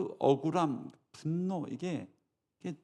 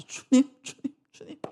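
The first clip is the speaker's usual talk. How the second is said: a sudden outburst that fades into a whisper; desperate prayer collapsing into a whisper; cry out then whisper; 9 semitones higher, 5 dB louder than usual. Retold or imitated.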